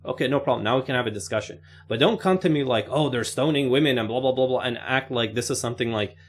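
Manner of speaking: usual speaking voice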